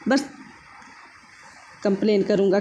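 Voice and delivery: normal talking voice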